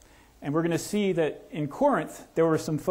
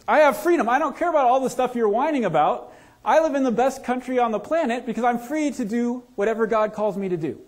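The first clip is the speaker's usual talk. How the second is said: defiant proud American; 6 semitones higher, 3 dB louder than usual. Retold or imitated.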